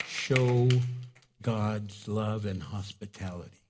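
normal talking voice

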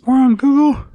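high, loud disbelief; shocked high-pitched question; surprised. Real, not acted. Surprised friend repeats.

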